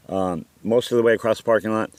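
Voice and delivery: ordinary delivery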